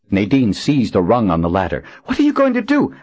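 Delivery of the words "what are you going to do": panicked high cry. A narrator's reading. Nadine panicked at ladder.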